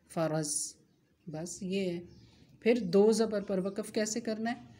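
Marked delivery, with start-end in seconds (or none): 0.1-0.5 फ़रज़ with the final vowel dropped, the last letter stopped; pausal form. Shown as a demonstration. Teacher demonstrates stopping on फ़राज़ा as फ़रज़. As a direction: stop on फ़रज़, ending on a silent ज़. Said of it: "जैसे फ़राज़ा से".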